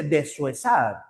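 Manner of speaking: deshuesar said de-SUE-sar; wrong syllable split. Shown as a demonstration. Teacher showing what nobody says, de-suesar. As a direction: glue the S onto UE in deshuesar. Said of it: "Nadie dice deshuesar".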